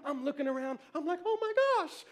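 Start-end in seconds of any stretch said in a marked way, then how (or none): 1.2-2.0 high-pitched retold astonishment; reenacted overwhelmed gasp of words; amazed. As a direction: exclaim it in happy disbelief. Retells his amazement at cheering.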